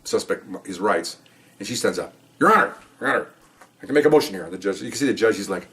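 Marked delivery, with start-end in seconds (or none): 2.4-4.5 objecting attorney. Retold or imitated. Acted attorney interrupting in court.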